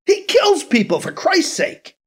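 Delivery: very high furious outburst; outraged shout. Acted. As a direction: explode in outrage. Fist shaking tirade.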